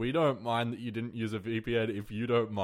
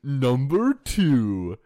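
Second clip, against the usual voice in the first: slow, loud list announcer; Watch Mojo; 5 dB louder, slower than usual. Acted. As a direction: draw out the heading like a countdown host. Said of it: "Do you like my Watch Mojo voice?".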